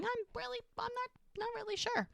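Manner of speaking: high, soft, unsure voice; hesitant retold answer. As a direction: falter up high, acting out uncertainty.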